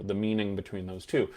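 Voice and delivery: ordinary talk, nothing marked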